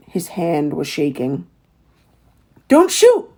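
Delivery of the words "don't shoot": high, loud cry to stop him; yelled. A narrator's reading. Boy yelling at the man not to shoot, high and loud. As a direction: shout it out in alarm. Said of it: "Little Willie yelled".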